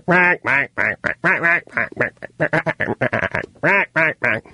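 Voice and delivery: long burst of high mock quacks; duck quacking. Host mocking the duck's suffering, imitation quacking. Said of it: "can they speak duck?".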